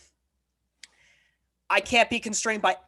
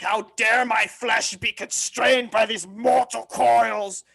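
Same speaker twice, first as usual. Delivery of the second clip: slow, loud, theatrical lament; slow, hammed-up mock outrage; mock outraged complaint; 2 semitones higher, 5 dB louder, slower than usual. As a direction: ham up the offended tone. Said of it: "the way Sargon made this joke is like".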